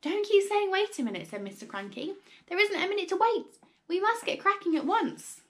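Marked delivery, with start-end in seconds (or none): 0.0-1.3 high, loud, impatient outburst; overexcited father losing patience; worked up. A narrator's reading. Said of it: "working himself up so much". 2.4-5.4 high, loud, urgent cry; overexcited, impatient father; worked up. A narrator's reading. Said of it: "working himself up so much".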